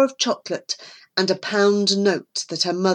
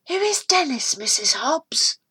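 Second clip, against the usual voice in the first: put-on puzzled boy; 8 semitones higher than usual. Norman asks the landlady.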